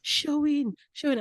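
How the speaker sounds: high pleading Sherwin calls; tearful calling. Retold or imitated. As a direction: call her name through tears. He started crying.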